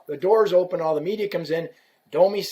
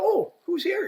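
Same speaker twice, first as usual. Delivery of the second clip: very high, sing-out feigned surprise; mock-surprised greeting; 14 semitones higher than usual. Businessman at his window spotting a visitor, high mock surprise. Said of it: "looks out his window and goes".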